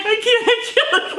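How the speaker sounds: high, laughing mock sob; retold sobbing. [name] retelling her Disney meltdown, laughing mock sob. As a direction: wail it like a sobbing kid who can't go on. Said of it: "Like, hard crying".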